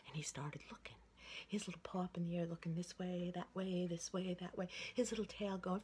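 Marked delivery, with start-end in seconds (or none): none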